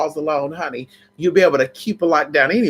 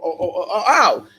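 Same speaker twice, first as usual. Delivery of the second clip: normal talking voice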